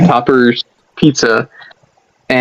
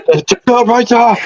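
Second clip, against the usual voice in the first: excited toast; 10 semitones higher than usual. Pretend. Toasting back.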